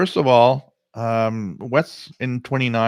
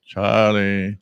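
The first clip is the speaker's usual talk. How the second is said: flat, machine-like robot voice; robotic voice; 4 dB louder, much slower than usual. Retold or imitated. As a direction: say it like a cold robot. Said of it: "you sound so robotic".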